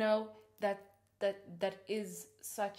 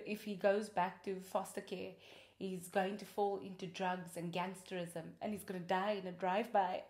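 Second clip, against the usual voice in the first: ordinary delivery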